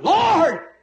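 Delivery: high, loud cry of Lord!; Paul cries out, struck by the light; retold scream. Paul screaming to the pillar of fire on the road. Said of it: "he screamed out".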